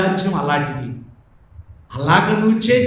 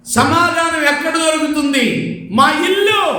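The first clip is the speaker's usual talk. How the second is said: proud shouted answer; 8 semitones higher than usual. Retold or imitated. Girl proudly answering the IAS interviewer, a shouted reply.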